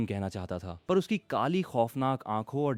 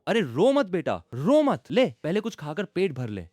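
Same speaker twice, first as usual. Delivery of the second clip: consoling mother; 6 semitones higher, 5 dB louder than usual. Acted. Mother consoling crying son-in-law.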